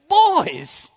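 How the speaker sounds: put-on high, loud old teacher calling out; imitating Miss Kenley. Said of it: "she said".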